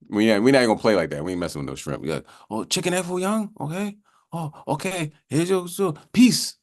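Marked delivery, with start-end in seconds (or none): 2.5-6.6 put-on high-pitched Chinese-accent takeout caricature; put-on Chinese accent, high and halting; mock Chinese accent. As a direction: play the order-taker in a mock Chinese accent. Acts a Chinese takeout order-taker.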